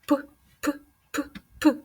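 short puffed P sounds like a bubbling pot; boiling pot puffs. Shown as a demonstration. Teacher to children, puffing P like a boiling pot. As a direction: puff out P P P like a pot boiling over. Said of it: "як же кипить каструля".

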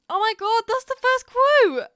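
very high, loud shriek of delight; excited shout. Real, not acted. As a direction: shriek it out, thrilled by the find. Reacting to a lucky game item.

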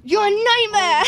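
loud, high, cracking into laughter; laughing mock accusation. Real, not acted. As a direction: laugh it out at the viewer. Laughing at a viewer.

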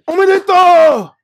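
high, loud, slow cheer; cheering congratulations. Retold or imitated. Police staff congratulate him on passing, loud cheer. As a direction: stretch out a joyful cheer.

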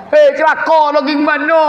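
high exclaimed rebuke; calling out sternly. Acted. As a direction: cry it out like a harsh challenge.

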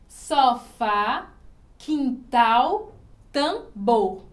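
"Sofá, quintal, tambor", split, final syllables loud; stress on last syllable. Shown as a demonstration. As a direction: punch FÁ, TAL, BOR, word by word. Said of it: "A sílaba mais forte que a gente ouve". ("Sofá, quintal, tambor" separado, com a última sílaba forte)

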